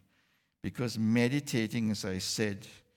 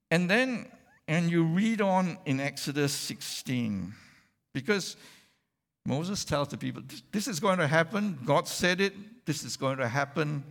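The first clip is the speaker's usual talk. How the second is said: preaching louder and higher, building to Moses' promise; rising preaching; 5 semitones higher, 4 dB louder than usual. Acted. Preacher rousing the congregation to Moses' promise, loud and fervent. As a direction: drive the promise home with mounting fervour.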